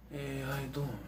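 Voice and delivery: near-whisper it, casual; Seagal whisper. Retold or imitated. Seagal greeting people in whisper voice. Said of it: "very whisper type voice".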